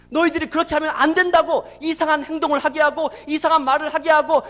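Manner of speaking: loud, driving pulpit proclamation; full-force preaching. Real, not acted. Preacher expounding God's word to Jeremiah, full-force preaching. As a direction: drive each phrase hard at the congregation.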